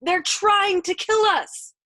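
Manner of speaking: slow, high, desperate screaming; frustrated shriek. A narrator's reading. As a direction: scream it drawn out, begging to be understood. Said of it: "Yossarian shrieked in frustration".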